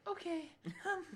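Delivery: high, hesitant little squeak; mock timid okay. Retelling why she agreed, mock timid. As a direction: imitate a sheepish agreement way up high. Voicing Katrina's hesitant reply.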